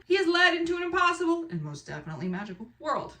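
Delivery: lively storyteller read-aloud; dramatic blurb reading. Acted. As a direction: read it like an exciting trailer. Reads the book's back cover.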